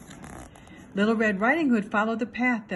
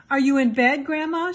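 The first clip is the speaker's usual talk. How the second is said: louder and higher, calling into the house; raised calling voice, searching; 4 semitones higher, 5 dB louder than usual. Acted. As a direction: call out to grandma from the doorway. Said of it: "she called out".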